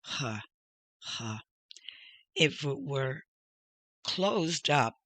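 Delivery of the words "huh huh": soft, airy "huh", twice; quiet H with gaps between; English H sound. Shown as a demonstration. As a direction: breathe out a light huh. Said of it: "The pronunciation is like our H".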